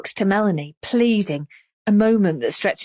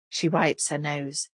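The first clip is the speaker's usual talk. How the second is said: usual speaking voice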